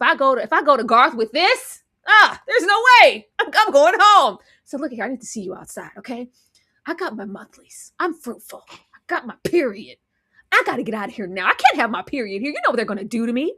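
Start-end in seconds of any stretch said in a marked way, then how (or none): 4.6-10.0 soft, quick conspiratorial voice; hushed secret. Retold or imitated. Daisy secretly telling Shunammite about her period, hushed and quick. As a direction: sneak the news out quietly.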